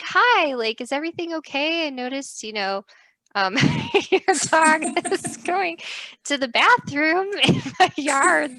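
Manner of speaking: be nice while you complain; awkward politeness. Retold or imitated. Confronting neighbor politely.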